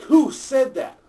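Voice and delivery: high, loud outraged shout; furious demand. Retold or imitated. Teacher freaking out at the class, loud outraged shout. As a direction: demand an answer, shocked.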